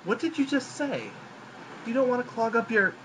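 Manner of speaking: exasperated narrator cry; startled outburst. Acted. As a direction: burst out in shocked disbelief.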